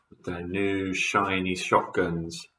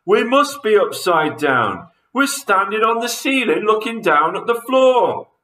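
loud, high voice of alarm; shocked gasp; 11 semitones higher, 5 dB louder than usual. A narrator's reading. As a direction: realise it aloud with mounting alarm. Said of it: "gasped Mister Twit".

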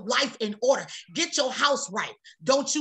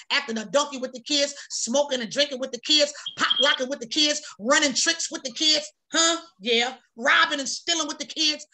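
ordinary talk, nothing marked

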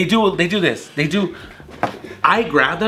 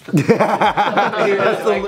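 normal talking voice

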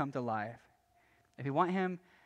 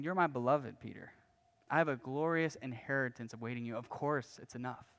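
low, hushed and tender; intimate reassurance; 3 semitones lower, 5 dB quieter than usual. Retold or imitated. Jesus answering Peter's worry in thought, intimate reassurance. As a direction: reassure a beloved friend, softly, slowing at the end. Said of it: "an intimate and passionate moment".

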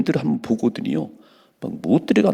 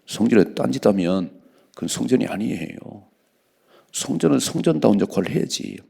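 ordinary delivery

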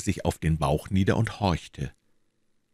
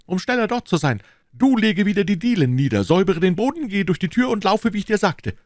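much higher, louder call; 14 semitones higher, 5 dB louder than usual. A narrator's reading. D'Artagnan calling to his servant as he leaps out, loud. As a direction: announce it boldly as he goes. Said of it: "rief D'Artagnan".

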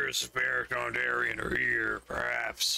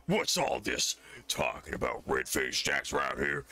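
deep orc bartender; 11 semitones lower than usual. Acted.

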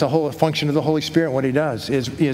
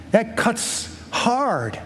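very slow, drawn-out "cuts hard"; pained emphasis; 7 semitones higher, much slower than usual. Real, not acted. Father asked to thank God for son's cancer, slow pained emphasis. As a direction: stretch each word, pitch lifting high.

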